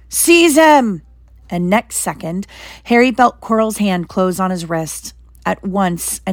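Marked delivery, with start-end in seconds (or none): 0.1-1.0 much higher, louder scream; screamed order. Acted. Voldemort screams at Quirrell to grab Harry. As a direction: shriek the command at Quirrell. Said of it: "but Voldemort screamed".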